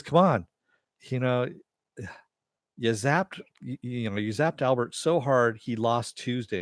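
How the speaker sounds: loud exasperated protest; exasperated complaint. Retold or imitated. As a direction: come on, really exasperated.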